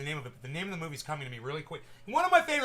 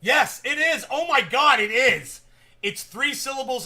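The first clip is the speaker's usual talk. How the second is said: excited; 8 semitones higher, 12 dB louder than usual. Real, not acted. Finally remembers the movie.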